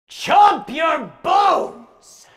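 very slow, high shouted punchline; creature's final scare, yelled; jump-scare shout. A narrator's reading. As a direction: pounce on the listener with it.

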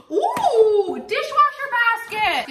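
high, loud ooh of discovery; excited delight. Real, not acted. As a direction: exclaim gleefully over a gift. Delighted at unboxed gift.

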